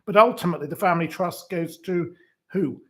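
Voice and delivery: usual speaking voice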